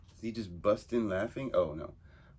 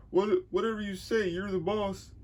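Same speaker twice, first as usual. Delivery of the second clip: high, loud timid henchman voice; nervous henchman; 11 semitones higher, 4 dB louder than usual. Acted. Henchman timidly asking the boss his plan. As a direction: squeak the question up at the boss.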